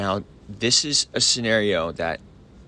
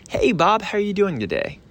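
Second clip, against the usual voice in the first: put-on high, bright-pitched chummy hello to an old friend; friendly greeting; 11 semitones higher than usual. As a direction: greet him like a buddy, pitched way up. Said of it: "sound as much like you're a friend".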